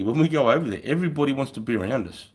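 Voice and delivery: usual speaking voice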